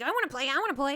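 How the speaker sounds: put-on high, loud, begging kid-brother voice; eager younger brother. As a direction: mimic an excited brother pestering for a turn.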